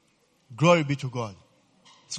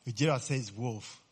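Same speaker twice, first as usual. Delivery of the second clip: ordinary delivery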